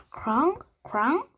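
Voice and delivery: lightly voiced soft bird croaks, rising; a gentle questioning croak; soft raven sounds. A narrator's reading. Raven making soft questioning croaks at Annie. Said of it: "made a soft ball-like sounds".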